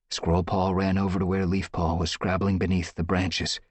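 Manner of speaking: ordinary talk, nothing marked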